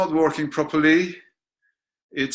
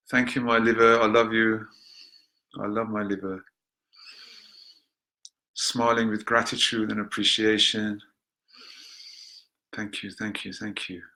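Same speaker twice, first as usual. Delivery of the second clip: tender thanks; 3 semitones lower than usual. Acted. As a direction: thank the liver lovingly. Thanks liver in meditation.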